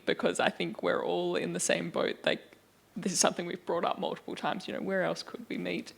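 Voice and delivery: normal talking voice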